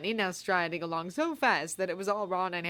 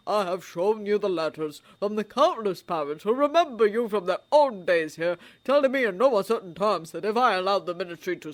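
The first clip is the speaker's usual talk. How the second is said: put-on louder, gently teasing old man; old wizard voice; 6 dB louder than usual. A narrator's reading. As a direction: tease kindly. Dumbledore's voice.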